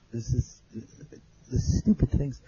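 normal talking voice

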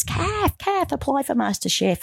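high, eager calls of her name; friends urging her. Retold or imitated. Friends urging Kath to enter MasterChef, high and eager. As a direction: nudge her like pushy friends who mean it.

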